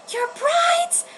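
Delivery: put-on very high, startled girl's question. Lettuce, shocked at Ciel's claim, in a high voice. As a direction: squeak it in disbelief.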